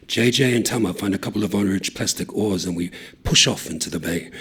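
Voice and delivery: ordinary delivery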